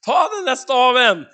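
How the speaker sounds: loud, high, urgent command; insistent order. Acted. God answering Moses' objection, insistent command. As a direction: press the order home loudly.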